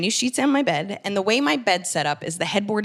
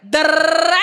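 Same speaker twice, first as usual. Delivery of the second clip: higher, louder da-da-da babble; rapid chatter noise; 7 semitones higher, 8 dB louder, faster than usual. Retold or imitated. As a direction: rattle on like an endless message. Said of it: "You're talking too much".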